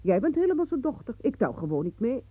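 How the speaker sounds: a loud offended protest; offended flare-up. Acted. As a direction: snap back, stung by the comparison. Sisters quarrel, she flares up.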